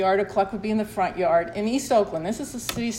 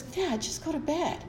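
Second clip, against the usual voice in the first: put-on pleading with drunk dad; 7 semitones higher, 6 dB quieter than usual. Telling drunk dad to go to bed.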